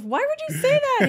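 high, loud mock-indignant question; exasperated retort. Acted exasperated reaction to bandmate's answer.

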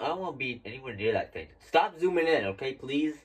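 ordinary talk

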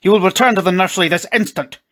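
loud, high bellow of command; angry shouted order. A narrator's reading. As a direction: thunder it at disobedient children. Furious father orders children out.

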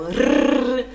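long loud tongue trill; rolled R. Shown as a demonstration. Teacher imitating Faustão's R, long loud trill. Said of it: "o famoso R do Faustão".

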